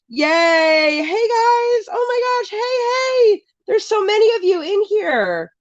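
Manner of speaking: high, delighted squeal of welcome; excited greeting. Real, not acted. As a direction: greet a crowd you're thrilled to see. Delighted greeting as viewers flood in.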